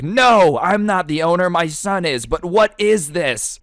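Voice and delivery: high, exasperated protest; loud "No", then drawn-out disbelief; mock indignant dad. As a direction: imitate an annoyed father objecting. Acting the annoyed dad's reply.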